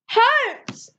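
high-pitched shout of a name; yelling. A narrator's reading. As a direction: call out sharply for attention. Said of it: "I yelled again".

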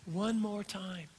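soft and slow, almost a whisper; tender closing refrain; hushed reflection. Acted. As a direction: let it land softly. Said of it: "sermon reflective climax".

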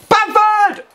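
high, loud name call; excited shout. Real, not acted. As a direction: yell his name as he shoots. Cheering his striker's chance.

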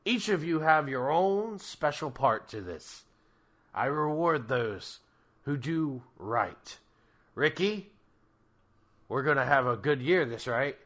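loud commanding Krampus; booming voice. Acted.

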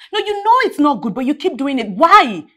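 harsh scolding. Acted. Best friend scolding Sam over the drunk calls, harsh. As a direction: tear into her, fed up. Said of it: "Girls, calm down".

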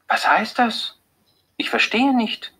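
astonished. A narrator's reading. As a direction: disbelief breaking into her voice. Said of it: "fragt Beate erstaunt".

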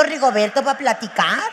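ordinary talk, nothing marked

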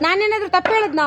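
indignant retort. Shown as a demonstration. Director demos the line.

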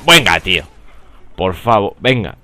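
queja fuerte y repentina; protesta indignada. Real, not acted. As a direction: protesta a voces por la jugada. Protesta al morir en la partida. (indignación real, en voz alta)

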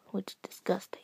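disgusted whispered aside. Real, not acted. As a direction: whisper it like you're grossed out.

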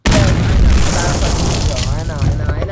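loud, repeated I know, exasperated. Real, not acted. Exasperated shout to viewers about her ex. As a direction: shout it at the viewers who already judge. Anticipating audience reaction, loud.

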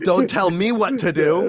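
loud, high, slow mock protest; mock defiance. Actor balking at the camera rule, mock defiance. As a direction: push back with hammed-up indignation.

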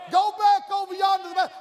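high, loud, fast divine order; shouted command. Acted. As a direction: boom it like God sending him back. God voiced commanding.

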